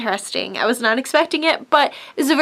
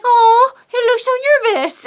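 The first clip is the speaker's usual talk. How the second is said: much higher, sudden worried gasp; surprised concern; 11 semitones higher, 4 dB louder than usual. Retold or imitated. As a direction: blurt it out high, surprised and worried. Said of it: "I was just like".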